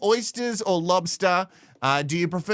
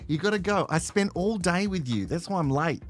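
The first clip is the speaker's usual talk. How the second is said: talking to the dog; 4 semitones lower, 5 dB quieter, faster than usual. Real, not acted. Coaxing her dog to leave.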